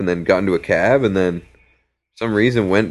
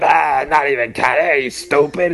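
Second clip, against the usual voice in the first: scornful high voice; 7 semitones higher than usual. Retold or imitated. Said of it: "He's like".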